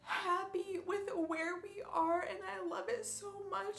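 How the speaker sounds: high, tearful voice of happy crying. Real, not acted. Overjoyed about the dogs' new home, crying. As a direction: cry with thankfulness for the new home. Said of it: "I don't know why I'm crying".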